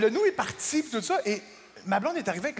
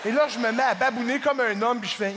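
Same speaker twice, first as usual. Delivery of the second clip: ordinary talk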